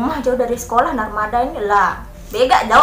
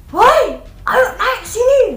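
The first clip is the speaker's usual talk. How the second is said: high, slow call across the road; shouting driver; 11 semitones higher, 4 dB louder, much slower than usual. Retold or imitated. Driver calling the girls across the road, loud slow shout. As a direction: yell over the traffic to the two girls. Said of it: "Dia teriakin dah saya sama teman saya".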